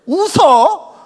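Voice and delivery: high mock rebuke; mock scolding parent. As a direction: snap it back like a baffled mother. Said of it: "또 이러실 거 아니에요".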